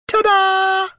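high squeaky gnome chorus; tiny gnomes cheering together, high. A narrator's reading. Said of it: "they chorused".

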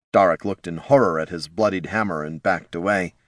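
ordinary delivery

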